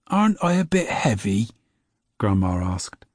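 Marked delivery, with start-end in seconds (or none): 0.1-1.5 higher, hesitant question; a little nervous, a little thrilled; nervous but excited. A narrator's reading. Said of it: "still nervous, but also a bit excited".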